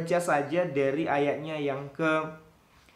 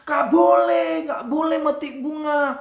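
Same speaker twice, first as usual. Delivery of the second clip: high, loud scolding shout; a mother shouting at her child over the flowers; angry mama; 10 semitones higher, 8 dB louder than usual. Retold or imitated. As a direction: snap at the child like a cross mother. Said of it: "mama marah".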